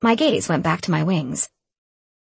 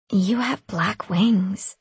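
slow, dreamy and faraway; much slower than usual. A narrator's reading. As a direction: drift through the words slowly. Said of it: "she stated in a dreamy voice".

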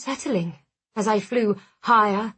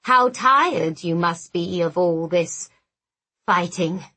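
disturbingly human monster; 4 semitones lower than usual. A narrator's reading. Skinless monster addresses cornered narrator, disturbingly human and soothing. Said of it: "his voice disturbingly human".

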